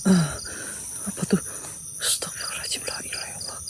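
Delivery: hushed, scared whispering; frightened whisper. Real, not acted. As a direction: whisper as if something is near. (bisikan ketakutan)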